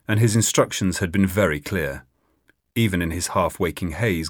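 ordinary delivery

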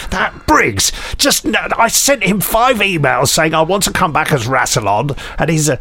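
a hint of an aggrieved star complaining; imagining Timothy Dalton. Host imagines snubbed actor grumbling at home, lightly voiced. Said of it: "sitting at home ... thinking".

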